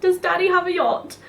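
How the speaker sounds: mocking posh customer's yacht question while laughing. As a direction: send up the customer's posh question, lilting high. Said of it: "I burst out laughing, like".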